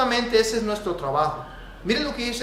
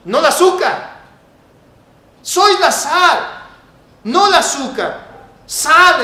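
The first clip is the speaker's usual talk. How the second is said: loud, high, slow, pounding sermon voice; fiery preaching; 7 semitones higher, 11 dB louder, slower than usual. Acted. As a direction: preach it hard to the congregation. Preaching at full force.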